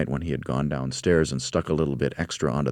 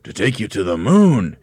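raised, loud mock astonishment; exclaiming; 4 semitones higher, 8 dB louder than usual. A narrator's reading. Husband echoing his wife's fear, loud exclamation. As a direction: boom it back, swelling on MOON. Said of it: "exclaimed Mister Twit".

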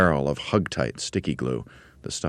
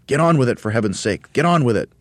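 high, loud exasperated push; frenzied urging; 7 semitones higher, 6 dB louder than usual. A narrator's reading. Monkey leader before the Twits return, frenzied urging. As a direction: hurry them along loudly. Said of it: "He was in a frenzy of excitement".

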